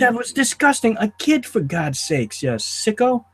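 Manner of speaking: high-pitched, indignant outburst; disgusted outrage. Real, not acted. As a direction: condemn him, appalled. Outraged at villain's treatment of child.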